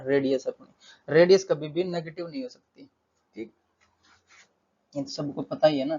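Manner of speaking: ordinary talk, nothing marked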